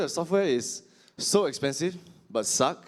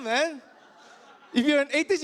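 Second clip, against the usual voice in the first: ordinary talk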